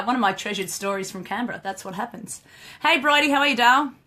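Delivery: ordinary talk, nothing marked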